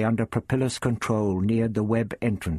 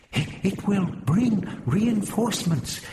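high, slow, faltering voice; hesitant murmur; 8 semitones higher, slower than usual. A narrator's reading. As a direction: hesitate anxiously on the first word. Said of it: "Helenia murmured".

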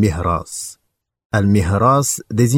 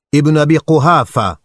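higher, louder call across the valley; 7 semitones higher, 5 dB louder than usual. A narrator's reading. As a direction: shout down from the mountaintop. Abu Sufyan calls from mountain. (appel lancé plus haut et plus fort)

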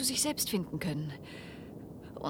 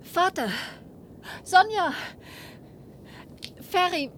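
loud, higher pleading calls; calling out; 7 semitones higher, 5 dB louder than usual. Acted. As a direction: call across to them. Calling out to family.

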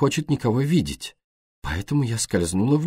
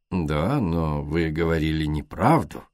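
softer and slow, deceptively gentle; 5 semitones lower, 3 dB quieter than usual. A narrator's reading. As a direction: velvet over steel, unhurried. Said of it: "с обманчивой мягкостью".

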